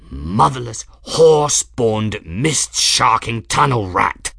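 snarled curses. A narrator's reading. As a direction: snarl each curse deliberately. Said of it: "Journeyman snarled".

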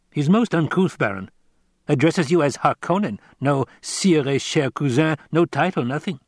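high, lilting tenor courtier; mock-scandalised report to the master; Piter.

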